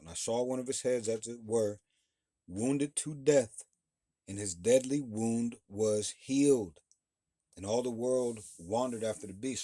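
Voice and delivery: preaching the verse. Acted. Bible teacher rereads verse three to his class, preaching it out.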